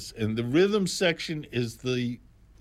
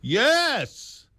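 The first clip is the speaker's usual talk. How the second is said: loud, high burst of yes; a real exclamation, much higher and louder; emphatic yes; 13 semitones higher, 5 dB louder than usual. Real, not acted. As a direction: shout the yes out, triumphant. Answering his own rhetorical question.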